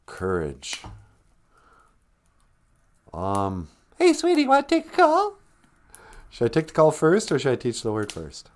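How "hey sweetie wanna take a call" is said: high, sing-song coaxing; sweet baby talk. Acted. Host cooing to child about calls. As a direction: coo at the little one.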